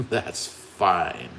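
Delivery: low, soft, drawn-out "that's fine"; understated imitation, dropped low and soft; imitated offhand "fine". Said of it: "he said it in a way like".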